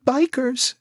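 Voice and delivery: high sobbed word; sobbing. Acted. Marcy answering Jack through a sob, high. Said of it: "she sobbed".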